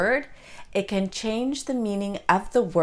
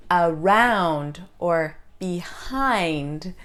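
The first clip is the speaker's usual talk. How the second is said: slow "around or behind", aROUND, beHIND; second-sound stress; 3 semitones lower, much slower than usual. Shown as a demonstration. Teacher giving exceptions around and behind, second-sound stress. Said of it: "stressed on the second sound".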